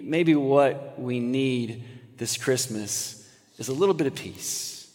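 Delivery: slow, hushed and soft. Acted. Preacher to congregation, slow hushed plea. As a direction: lean in and slow down. Soft reflective sermon turn.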